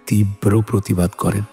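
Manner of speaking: usual speaking voice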